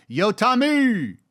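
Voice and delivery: high, slow, drawn-out call; fan calling out. Retold or imitated. Fan calls out to Tom on the street, drawn out and high. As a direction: hail him from across the street. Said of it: "I get a lot of that".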